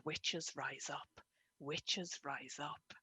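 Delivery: slow, hushed chant-like recital; poem recitation. Shown as a demonstration. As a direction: intone it slowly like an incantation. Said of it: "this is often how words and poetry comes to me".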